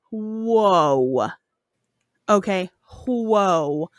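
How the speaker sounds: low, slow, drawn-out whoa; mock startled king. King walking in on the burning, mock shock. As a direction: halt everything with a long low whoa. King bursts in to stop queen.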